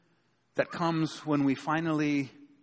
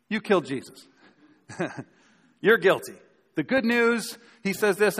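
usual speaking voice

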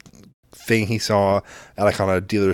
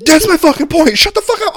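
loud, very high retold outburst; exasperated yelling; 7 dB louder than usual. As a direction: re-enact blowing up at someone, voice shooting up. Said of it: "you don't deserve to get yelled at".

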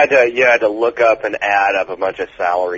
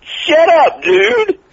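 shrill high shout, much higher; mock outrage; 6 dB louder, much slower than usual. Real, not acted. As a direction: yell it in playful outrage. Friend teasing, mock outrage.

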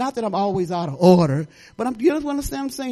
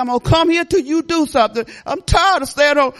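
louder, higher insistent plea; pleading to God; 6 semitones higher, 5 dB louder than usual. Retold or imitated. Mother retelling her plea to God to return to church, louder insistent plea. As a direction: demand it of the Lord. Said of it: "I'm saying".

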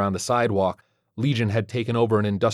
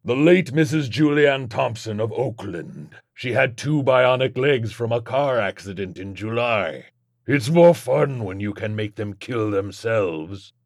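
slow, drawling demon taunt; leisurely demonic gloating; mocking demon Legion; slower than usual. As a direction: gloat slowly over the victim.

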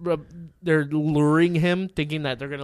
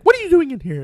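high, loud scolding question; mock outraged teacher; shrieking teacher; 14 semitones higher, 6 dB louder, faster than usual. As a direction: demand it like an alarmed teacher. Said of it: "the teacher's like".

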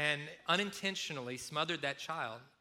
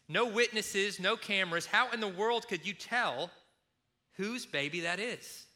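ordinary talk

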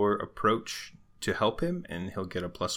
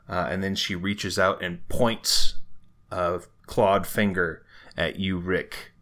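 ordinary talk, nothing marked

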